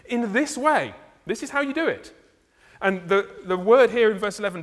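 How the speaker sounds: loud, high, insistent sermon delivery; rising pulpit fervour; preaching at full force. Acted. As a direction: drive the point home to the pews. Preaching climax on kingdom entry.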